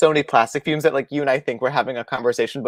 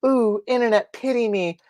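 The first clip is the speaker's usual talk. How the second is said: whiny pity plea; 7 semitones higher than usual. Pretend. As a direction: beg for sympathy, hammed up. Mocking pity-seeking crafter.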